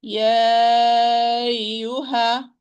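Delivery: "يا أيها" slowly, with a long held "yaa"; recitation demo. Shown as a demonstration. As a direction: stretch the "يا" on one steady note in "يا أيها". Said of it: "We are going to say".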